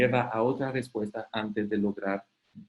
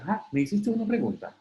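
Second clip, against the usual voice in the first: much higher, caught-off-guard voice; mock surprise; 10 semitones higher, faster than usual. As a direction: play someone who never noticed the question. Acted dialogue, surprised answerer. (sorpresa fingida, en tono mucho más agudo)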